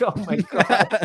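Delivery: slow, laughing oh my God; laughing disbelief. Real, not acted. Laughing at the commentator's cutting remark, slow and amused. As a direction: break into laughter mid-phrase.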